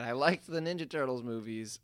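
normal talking voice

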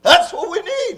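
high, forceful pulpit delivery; preaching hard. Retold or imitated. Preacher acts out the man who started preaching, hard and high. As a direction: preach it as hard as you can. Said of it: "preaching just as hard as he could".

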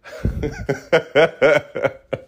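long laugh at own joke; amused run of laughter. Real, not acted. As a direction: crack yourself up with a long chuckle.

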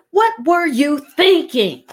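high, emphatic "What were you thinking"; stress on every word. Shown as a demonstration. Showing another way to ask, high emphasis on each word. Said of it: "Or just".

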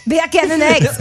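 loud, high accidental Jamaican lilt; Jamaican-sounding accent. Shown as a demonstration. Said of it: "You sound Jamaican".

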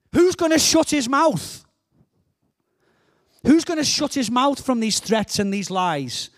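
high, loud indignant demand; fired-up challenge, starting high then easing; David. Acted. David rallies the army against Goliath's lies, passionate demand. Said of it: "get passionate, it goes higher".

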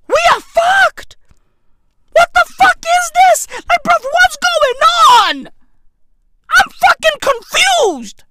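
panicked rant. Acted. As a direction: freak out in a high squeal.

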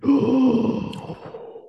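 high, offended priest's gasp; mock shocked gasp. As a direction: gasp as if scandalized. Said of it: "He said".

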